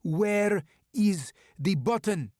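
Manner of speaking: put-on slow, loud, menacing Russian accent. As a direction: spell out each word with a heavy Russian accent. Russian attacker repeats demand.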